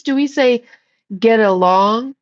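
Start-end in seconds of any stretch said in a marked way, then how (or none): none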